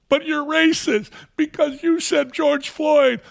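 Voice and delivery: sneering high-pitched parody of a critic; mocking accuser. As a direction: mimic a shrill accuser.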